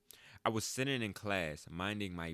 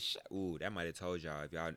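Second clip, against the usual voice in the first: usual speaking voice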